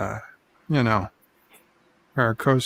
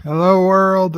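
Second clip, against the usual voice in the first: announcer-style catchphrase, louder opening; catchphrase on request; 6 semitones higher, 5 dB louder than usual. Acted. Cohost asks him to repeat his intro, announcer-style. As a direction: boom out the show greeting. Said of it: "Say that again".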